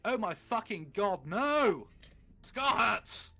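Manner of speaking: high, loud dramatic scream; hammed-up cry of agony. A narrator's reading. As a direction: scream it out like someone in sudden pain. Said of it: "he started to scream".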